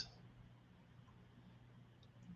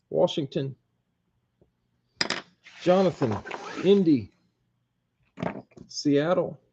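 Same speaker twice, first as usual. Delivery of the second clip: higher, announcer-style call of each name and team; live draw announcer; 5 semitones higher than usual. Acted. Host announcing each collector's team to the viewers, in a higher announcer voice. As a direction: reveal every pick like a live draw.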